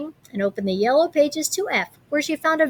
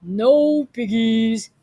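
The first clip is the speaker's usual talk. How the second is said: very slow, drawn-out cow voice; mooing cow; 3 dB louder, much slower than usual. Acted. The cow answering Gritch, slow lowing voice. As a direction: moo the words lazily. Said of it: "mooed the cow".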